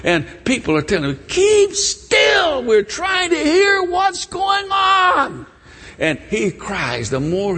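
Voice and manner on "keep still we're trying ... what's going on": high, irritated yell; annoyed crowd hollering; scolding shout. Acted. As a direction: shout him down, stretching the last words. Crowd telling the beggar off.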